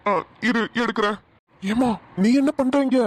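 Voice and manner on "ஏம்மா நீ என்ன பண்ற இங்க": startled loud question on finding her hiding; surprised. Acted. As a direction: caught off guard, louder and sharper. Surprised to find hidden girl. (திடுக்கிட்டு சத்தமாக கேட்பது)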